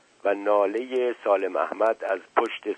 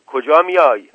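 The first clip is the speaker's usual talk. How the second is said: loud, high warning question; shouting; 6 semitones higher, 10 dB louder than usual. A narrator's reading. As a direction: shout to stop someone approaching. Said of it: "زکریا داد زد". (با داد و هشدار)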